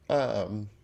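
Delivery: quiet, choked-up voice; fighting back tears; holding back tears. Real, not acted. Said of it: "I'm trying not to cry".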